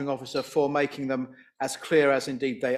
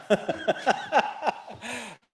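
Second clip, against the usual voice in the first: real, higher-pitched laughter; amused laugh; 7 semitones higher than usual. Real, not acted. Councillor teased the chair for sounding surprised, amused laughing. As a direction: laugh heartily for a couple of seconds.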